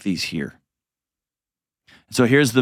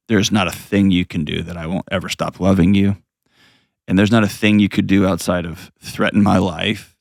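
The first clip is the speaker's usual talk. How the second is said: ordinary delivery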